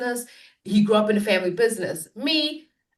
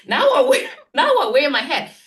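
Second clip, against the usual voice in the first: laughing; 4 semitones higher, 5 dB louder than usual. Real, not acted. Laughing triumph about hat.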